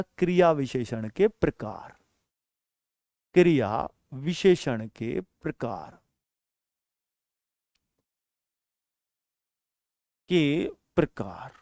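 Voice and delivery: क्रिया विशेषण के प्रकार spoken slowly and softly; slow announcing. Shown as a demonstration. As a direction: lay out the heading slowly, word by word. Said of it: "जरा ध्यान से सुनना".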